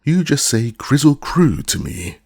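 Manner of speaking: put-on boy snapping at the woozy, loudly; terribly angry. A narrator's reading. Boy to beast, in a loud angry demand. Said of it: "inquired the boy, terribly angry".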